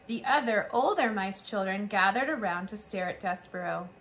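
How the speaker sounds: plain reading voice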